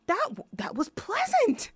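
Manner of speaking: a hammed-up high imitation of the violin teacher; imitating the teacher. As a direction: parrot the teacher in a high put-on voice. Teacher's odd compliment retold.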